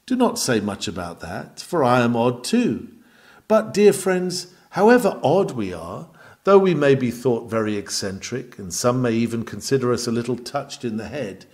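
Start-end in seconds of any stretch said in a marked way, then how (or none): none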